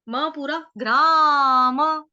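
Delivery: ordinary talk, nothing marked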